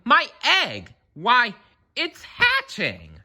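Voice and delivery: very high excited cry; shouted. A narrator's reading. Horton seeing his egg hatch, high excited shout. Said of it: "shouted Horton".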